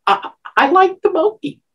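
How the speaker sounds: high, halting little answer; high mock shy reply. Woman retelling her reply to George, high and tongue-tied. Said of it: "I said".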